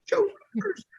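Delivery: starstruck. Retold or imitated. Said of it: "it's like".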